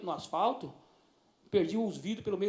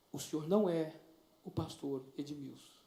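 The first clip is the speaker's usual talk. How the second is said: put-on soft, hesitant young girl's voice; lightly voiced shy teenager, softer; timid girl; 5 semitones lower, 6 dB quieter than usual. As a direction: ask him gently, almost afraid. Shy teenage girl addressing pastor.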